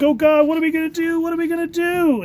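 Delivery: high, hammed-up panicked wail; mock panic. Trapped partygoers in the mine, high mock panic. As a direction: play trapped partygoers losing it. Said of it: "And they're like".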